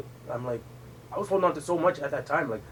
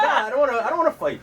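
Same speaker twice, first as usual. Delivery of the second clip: high-pitched, half-laughing refusal; retold protest; 9 semitones higher than usual. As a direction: plead your way out of a fight, retelling it. Retells refusing fight with brother.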